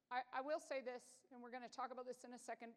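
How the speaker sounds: ordinary talk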